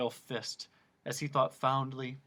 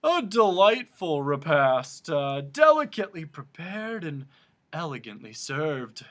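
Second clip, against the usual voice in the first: pompous, slow announcing voice; hammed-up pompous humbug; 4 semitones higher, 8 dB louder than usual. A narrator's reading. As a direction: proclaim it grandly to the room. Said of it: "he announced to no one in particular".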